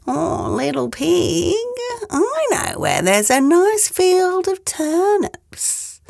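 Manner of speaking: high, slow coaxing voice; sly wolf. Acted. Wolf tempts the pig with turnips, slow and high.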